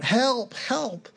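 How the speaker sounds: high-pitched cry of help from a cliff; mock scream for help. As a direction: call out high like a man dangling. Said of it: "he just started screaming out".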